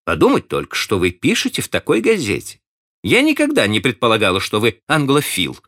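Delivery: a touch of teasing scorn; mock reproach. A narrator's reading. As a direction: Miss Ivors chiding him about the newspaper. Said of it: "решительно отрезала мисс Айверс".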